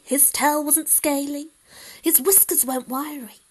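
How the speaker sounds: usual speaking voice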